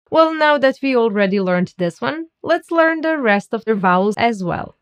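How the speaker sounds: usual speaking voice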